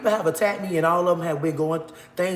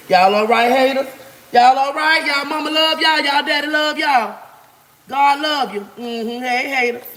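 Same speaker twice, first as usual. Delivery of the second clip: taunting; 6 semitones higher, 4 dB louder than usual. Real, not acted. Taunting haters on livestream.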